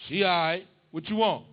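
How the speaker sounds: loud, gruff dad cutting to the chase; impatient dad. Retold or imitated. Son calls dad, dad loud and impatient. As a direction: bark it like a dad who knows you want money.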